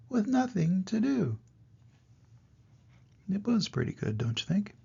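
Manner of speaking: lively storybook read-aloud; reading to children. A narrator's reading. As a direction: read the rhymes to little kids. Grandpa reads rhyming picture book.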